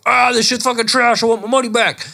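high, mock-furious crowd yell; indignant customer shouting at the show; angry heckler. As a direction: heckle the stage in mock outrage. Acted furious audience member.

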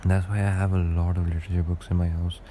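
usual speaking voice

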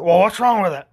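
mildly mocked pushy customer; impatient customer. Customer presses the expert for an answer, impatient.

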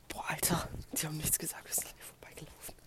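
hushed relieved whisper. Real, not acted. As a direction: whisper it so passers-by can't hear. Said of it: "jetzt nicht so laut".

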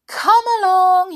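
high, loud call to his friends; a fairy calling his gang to mischief, loud and high. Acted. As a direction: shout for the others to follow. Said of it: "he called to the others".

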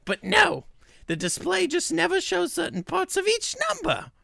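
high, piercing reviewer voice; high-pitched put-on voice of an upset customer; Ginny's voice. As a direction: read her review in a high, whiny voice. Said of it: "This is what Ginny sounds like to me".

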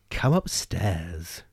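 slow, low, soft sinister witch. Acted. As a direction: draw the words out menacingly. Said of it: "The witch said".

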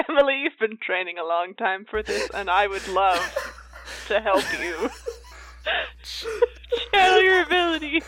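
cracking up mid-sentence; laughing through lines. Acted. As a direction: try to stay earnest but laugh. Morwena's earnest plea, laughing.

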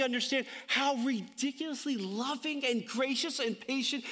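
high, awestruck preacher's pitch, words stretched out; impassioned preaching. Real, not acted. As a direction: preach it with amazed, mounting fervour. Preaches with rising fervour.

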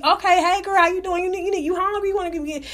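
mock the overly nice greeting; hammed-up polite host offering food; imitating sweet nice voice. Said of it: "he more nicer than I am".

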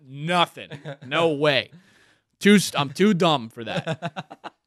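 ordinary talk, nothing marked

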